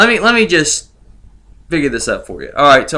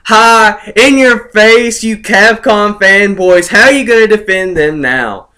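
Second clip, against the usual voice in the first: mock taunt, higher and louder; taunting gloat; 5 semitones higher, 4 dB louder than usual. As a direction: rub it in their faces. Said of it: "this is me just saying".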